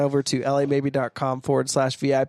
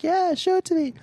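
much higher, girlish imitation; high mock woman voice. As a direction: squeak it out like a woman. Voicing the woman in a joke.

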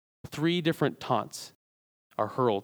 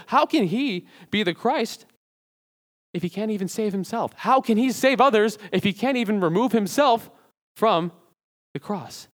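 higher and louder, rising scornful questions; mocking rhetorical question; 7 semitones higher, 4 dB louder than usual. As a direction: sneer it out as the jeering crowd. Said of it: "they're saying".

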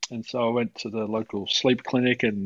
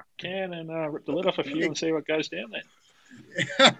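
higher, put-on broad Aussie larrikin; hammed-up Aussie accent; 6 semitones higher than usual. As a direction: lay the Australian accent on thick. Hamming up Aussie stereotype.

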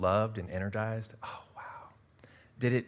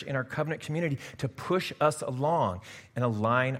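higher, louder, driving sermon delivery; full-force preaching; 7 semitones higher, 7 dB louder than usual. Acted. Pastor driving home the dog and bone image, full-force preaching. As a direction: preach it hard, building the image.

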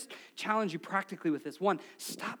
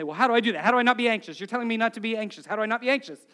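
louder, agitated questioning; mock anxious; 7 dB louder, much faster than usual. Congregation asks how not to be anxious, mock agitated. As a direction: imitate a worried listener demanding an answer. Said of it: "I know that's what you're feeling".

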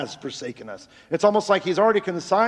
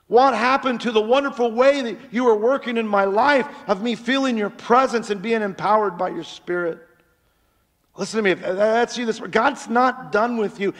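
higher, louder preaching that slows at the end; full-force preaching; 6 semitones higher, 4 dB louder than usual. Acted. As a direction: preach it out to the whole church. Preacher exhorting the congregation.

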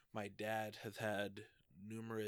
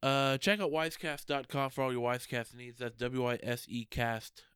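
upbeat, louder plug read; 2 semitones higher, 9 dB louder than usual. Acted. Host plugging the website to listeners, upbeat ad read. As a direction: sell it like a radio spot.

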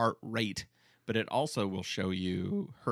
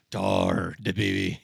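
put-on rough sea-captain accent, a touch louder; mock Quint accent; gruff Quint impression; 3 semitones lower, 6 dB louder, slower than usual. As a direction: growl it like a salty old shark hunter. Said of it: "accent turned into".

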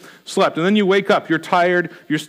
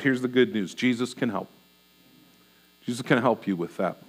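softer, lower, gentle good news; reassuring; 7 semitones lower, 8 dB quieter than usual. Real, not acted. Preacher gently comforting the anxious congregation. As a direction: ease the worried hearer with comfort.

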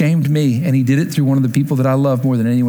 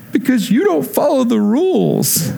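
tearful; 8 semitones higher than usual. Retold or imitated. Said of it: "she tears up".

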